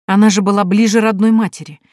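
ordinary delivery

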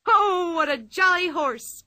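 high, loud, slow gleeful cry; delighted cry. Acted. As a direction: crow it out with glee. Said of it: "cried Pippi".

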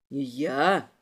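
low hissing question; low, incredulous hiss; hissed disbelief. A narrator's reading. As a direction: hiss it back indignantly. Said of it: "прошипел у меня из-за спины тот".

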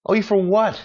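loud, high-pitched disbelief; indignant retort. Acted. Answering posts asking for money, loudly indignant. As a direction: throw it back, voice leaping up.

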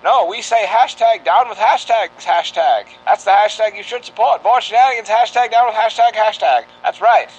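put-on old-time radio campaign read; rally the public like a newsreel; 1940s radio announcer. Mock public service campaign.

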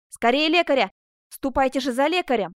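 commanding, pressing cry; urgent shout. A narrator's reading. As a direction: call out in alarm for a doctor. Calling urgently for a doctor.

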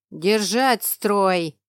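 slow, loud barked command; barked order. Acted. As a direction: bark at the troops to hold. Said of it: "рявкнул". (рявкающий приказ)

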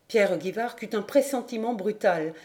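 usual speaking voice

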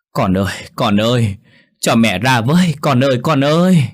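begging her son to let her out; desperate cries to her son; old mother calling out. A narrator's reading. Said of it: "đập cửa gọi tên thằng con mình".

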